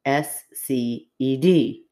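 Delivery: slow, deliberate letter by letter; spelling it out. Shown as a demonstration. Co-host spelling a word out slowly on request. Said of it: "spell it again".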